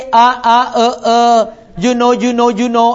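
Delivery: stammering you know you know; mock a nervous rambler; filler-laden mumble. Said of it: "and don't say".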